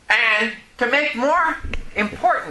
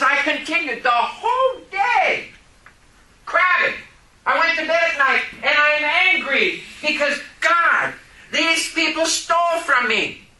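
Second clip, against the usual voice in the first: angry crying out; 8 semitones higher than usual. Retold or imitated. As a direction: wail it out like a wronged man. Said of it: "I was mad".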